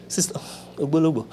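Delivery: fast whispered aside; hushed quick aside. Real, not acted.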